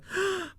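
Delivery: short, high, startled gasp; mock gasp. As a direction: gasp as if you've seen a monster. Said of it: "be like".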